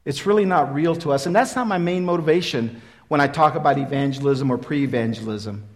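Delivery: ordinary talk, nothing marked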